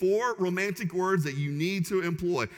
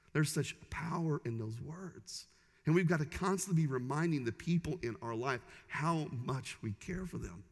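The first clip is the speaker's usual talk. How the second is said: ordinary talk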